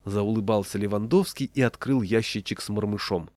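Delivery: ordinary delivery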